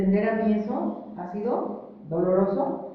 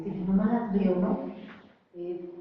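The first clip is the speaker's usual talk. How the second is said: normal talking voice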